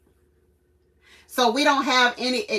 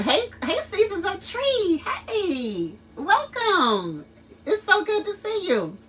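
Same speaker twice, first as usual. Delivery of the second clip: high bright customer-service voice; cheery store greeting; 9 semitones higher than usual. Retold or imitated. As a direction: greet a shopper with put-on cheer. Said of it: "maybe I can say thank you".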